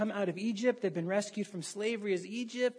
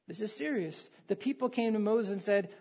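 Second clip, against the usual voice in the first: ordinary talk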